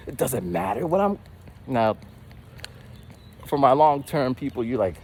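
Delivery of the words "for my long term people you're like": normal talking voice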